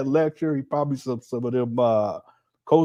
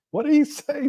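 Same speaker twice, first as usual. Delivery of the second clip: disbelief; 11 semitones higher than usual. Real, not acted. Streamer reacts in disbelief to the clip.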